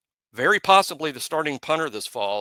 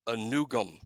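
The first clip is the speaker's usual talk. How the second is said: ordinary talk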